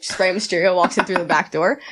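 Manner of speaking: normal talking voice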